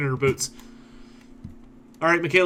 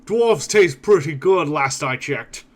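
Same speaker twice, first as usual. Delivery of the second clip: slow, louder ogre menace; 3 semitones higher, 5 dB louder, much slower than usual. Acted. Ogre looming over the little dwarf, slow mocking menace. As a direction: savour the threat like a hungry brute. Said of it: "He looks down and goes".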